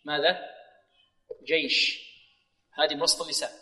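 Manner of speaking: ordinary talk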